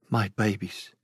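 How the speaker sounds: a mother's hushed despair; mother fearing for her babies, softly anguished; anguished murmur. Acted.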